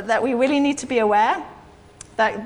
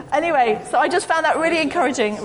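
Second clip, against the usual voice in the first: normal talking voice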